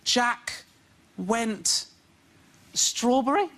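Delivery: put-on halting, questioning child sounding out words; dad imitating his son stumbling through a reading book; son reading aloud. Dad mimics son's halting guess at the word. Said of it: "when my son goes".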